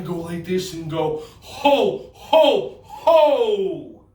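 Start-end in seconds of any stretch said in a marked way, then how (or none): 1.4-4.0 loud, high-pitched ho-ho-ho; Santa laugh. Shown as a demonstration. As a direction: belt out each "ho" separately. Mock Santa laugh for kids.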